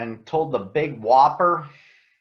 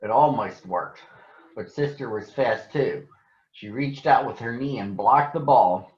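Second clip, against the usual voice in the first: gentle storybook narration; reading to children; 2 semitones lower than usual. A narrator's reading. Reading a Berenstain Bears book to schoolchildren, gentle storytelling. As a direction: read it like a picture book for kids.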